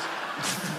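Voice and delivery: imitated snort of suppressed laughter; stifled snort. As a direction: snort as if fighting giggles in church. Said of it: "we snort like that".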